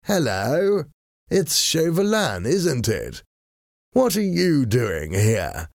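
high, slow, languid fop's drawl; idle aristocrat, unbothered and slow; bored voice. A narrator's reading. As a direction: greet your enemy as if he barely interests you. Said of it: "in his usual bored voice".

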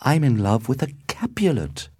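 dismayed disbelief. A narrator's reading. Romeo shocked at his discovery.

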